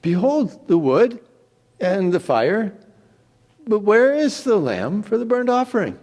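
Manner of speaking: put-on high, innocent boy's question; a boy puzzled about the missing lamb; young Isaac asking. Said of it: "And he said".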